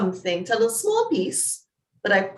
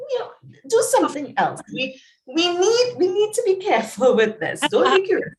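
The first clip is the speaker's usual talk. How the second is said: mock alarm; 11 semitones higher, 4 dB louder than usual. Mock alarm about heart surgery.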